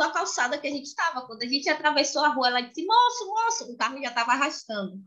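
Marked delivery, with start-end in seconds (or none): 2.9-3.7 high, calling out to a stranger; child calling out. Retold or imitated. Daughter calling the driver to stop, high-pitched. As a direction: call the man like a small girl.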